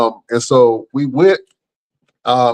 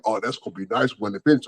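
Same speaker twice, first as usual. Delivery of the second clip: softer, fast, casual cop voice; a hint of a smug officer, soft and fast; offhand cop remark; 4 semitones lower, 8 dB quieter, much faster than usual. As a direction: toss it off quietly and quick. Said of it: "he was like".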